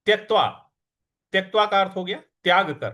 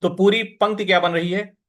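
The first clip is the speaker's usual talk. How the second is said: ordinary talk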